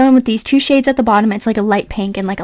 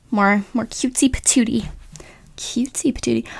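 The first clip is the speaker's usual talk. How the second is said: playful whisper; 7 dB quieter than usual. Acted. Playful whispered aside on gloss.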